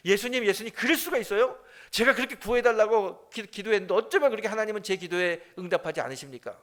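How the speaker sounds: loud, high-pitched indignant complaint; acted angry protest. Drowned churchgoer confronting Jesus in heaven, acted angry protest. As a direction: take Jesus to task, mock-outraged. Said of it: "화가 나가지고 막 예수님께 따집니다".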